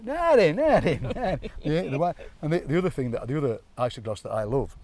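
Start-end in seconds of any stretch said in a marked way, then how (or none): 0.0-1.4 loud, high Hillsborough accent caricature. Shown as a demonstration. Punchline of the drive to Hillsborough, loud broad accent. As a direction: blare it out in broad Sheffield. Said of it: "By the time you get to Hillsborough".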